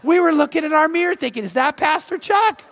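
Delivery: high, loud gushing; imitating an excited churchgoer; delighted recognition. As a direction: squeal it like a thrilled fan, stretching the name. Other driver's delighted recognition, acted.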